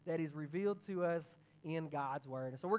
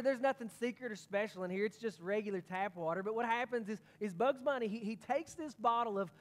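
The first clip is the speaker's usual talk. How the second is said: ordinary talk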